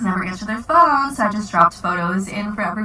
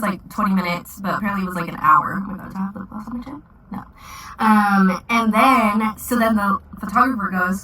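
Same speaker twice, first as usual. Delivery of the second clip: usual speaking voice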